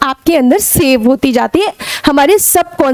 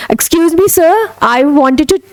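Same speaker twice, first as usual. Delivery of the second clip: slow, careful polite English to a teacher; slow polite demo; much slower than usual. Shown as a demonstration. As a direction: ask politely and slowly. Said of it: "कोई sir जा रहे हैं".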